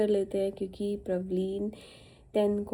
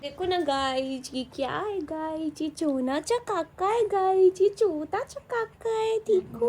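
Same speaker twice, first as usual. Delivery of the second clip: high sing-song cooing, climbing higher; 9 semitones higher than usual. Real, not acted. Mother showing her baby to viewers, high cutesy baby talk. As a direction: babble playfully in a tiny high voice. Playful babble over the baby.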